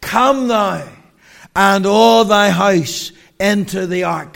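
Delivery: loud, commanding divine summons; voice of God calling. Retold or imitated. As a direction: call out like the voice of God. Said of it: "God's P A system comes in".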